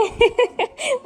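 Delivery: high giggling burst; can't stop giggling on the ride; laughing. Real, not acted. Wife laughing on the train with her husband, giggling. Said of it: "इतनी हंसी आ रही थी मुझे".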